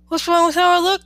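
mildly anxious. A narrator's reading. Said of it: "Daniela asked anxiously".

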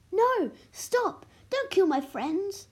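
high, loud shouted plea; acted alarm, pitch shooting up; urgent plea. A narrator's reading. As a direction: cry out to stop a killing. Pleading for friends' lives in story.

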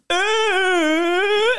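put-on very high, loud drunken singing wail. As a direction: belt a bad note like a wasted singer. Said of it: "he's going".

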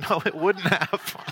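chuckling gently through it; laughing denial. Retold or imitated. Dad answering son, laughing.

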